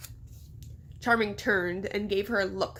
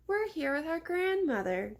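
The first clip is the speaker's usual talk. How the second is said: put-on little girl voice; 7 semitones higher than usual. A narrator's reading. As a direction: answer sweetly like a small girl. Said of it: "Daphne answered".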